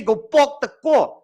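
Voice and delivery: "Go park the car." with rounded Cape "o" vowels, pawk and caw; Cape Town accent. Shown as a demonstration. Teacher mimicking the Cape students' "Go park the car.". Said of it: "You guys say".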